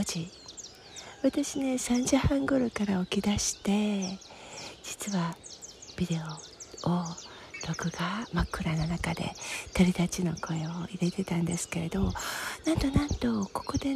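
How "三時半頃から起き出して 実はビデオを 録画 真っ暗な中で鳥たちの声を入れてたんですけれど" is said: hushed breathy whisper. Real, not acted.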